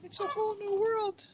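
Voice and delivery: high, delighted cat voice for the pet; voicing the cat. Owner speaking for his indoor cat rolling in tar, high, delighted voice.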